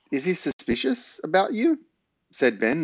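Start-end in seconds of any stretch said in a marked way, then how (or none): 0.1-1.8 higher-pitched worried question; panicked. A narrator's reading. Boy asks granny about the suspicious neighbour, mildly panicked. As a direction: ask with a touch of panic. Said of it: "more than a little panicked".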